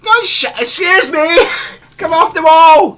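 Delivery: high, very loud yelling; hammed-up yell with a laugh in it; acted officer's shout. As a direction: bellow like an officer collaring someone. Acts out officer yelling at offenders.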